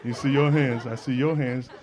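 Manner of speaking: ordinary talk, nothing marked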